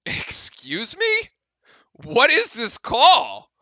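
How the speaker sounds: indignant. Real, not acted. Streamer questioning the referee's call, slow indignation. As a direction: drag it out in mock disbelief.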